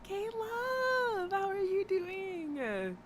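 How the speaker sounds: high, slow drawn-out hello; high-pitched slow sing-song greeting; sweet greeting. Real, not acted. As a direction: greet the viewer sweetly and slowly. Greeting a viewer by name.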